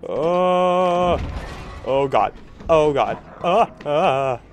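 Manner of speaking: loud, high panicked cries; startled shrieks of fear; frightened yelps. Real, not acted. Monster chase, startled cries.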